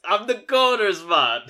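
put-on slow, high joking Jamaican accent; drawn-out Jamaican accent, playful. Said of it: "in a Jamaican accent".

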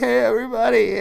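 high-pitched, hammed-up weepy hello; mock tearful greeting. Pastor on the mic after crying at a baptism, mock tearful. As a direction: imitate greeting a crowd while choked up. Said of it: "he starts crying, and I start crying".

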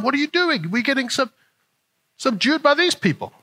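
high, huffy voice of offended believers; indignant churchgoers. Retold or imitated. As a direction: play the outraged church crowd scolding Gideon. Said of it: "I can imagine the rhetoric that was happening".

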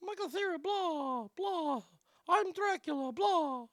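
soft, very high, timid Dracula attempt; Michael Cera. Host shows an actor earnestly trying Dracula, soft Michael Cera voice. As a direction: play Dracula in a small, high, quiet voice. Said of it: "Michael Cera would at least try".

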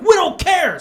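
loud, high bully's retort; callous mock yell. Attackers dismissing a man's plea, loud jeer.

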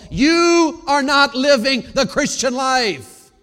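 slow, loud, every word hammered; slowed preacher's shout; full-force preaching. Acted. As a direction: drive the verdict home.

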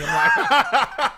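high burst of laughter; laughing. Real, not acted. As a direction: crack up mid-story. Laughing at the retelling.

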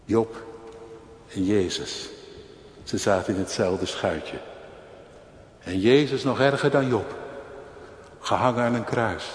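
normal talking voice

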